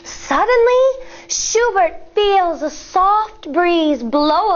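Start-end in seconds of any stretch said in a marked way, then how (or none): none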